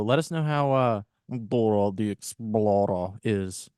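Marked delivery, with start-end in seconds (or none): 1.5-3.7 put-on slow Russian accent, drawn out. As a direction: say it with a Russian lilt. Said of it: "you got that Russian intonation".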